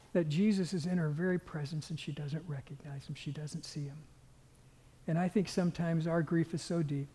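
normal talking voice

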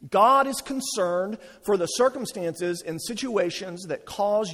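fervent pulpit proclamation of God's care; preaching at full force. Acted. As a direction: build the sermon to a passionate peak.

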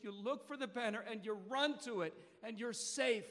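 preaching at full force. Acted. Preacher urging the church to the banner, preaching at full force. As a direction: preach it hard to the crowd.

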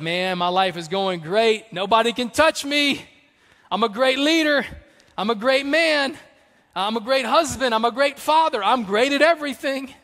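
high, loud, puffed-up boast; boastful bragging. Retold or imitated. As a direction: crow about yourself like a proud king. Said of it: "He's like".

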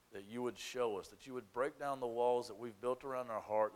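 soft, low, hushed prayer; soft prayerful voice. Acted. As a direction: pray quietly and earnestly to God. Pastor's opening prayer.

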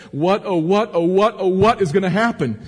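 high, loud dramatic wail of worry; anxious lament. Acted. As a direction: cry it out as if overwhelmed. Voicing fear of being overwhelmed.

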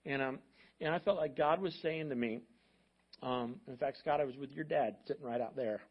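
ordinary talk, nothing marked

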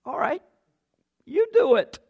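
Jesus gently daring them, smiling; wry smirk. Retold or imitated. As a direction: say it with a teasing turn of the mouth. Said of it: "a little upward turn of the mouth".

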